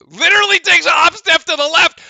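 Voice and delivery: loud, high incredulous outburst; outraged. Real, not acted. Streamer describing the ref's bad call, loud outrage. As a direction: explode at the replay.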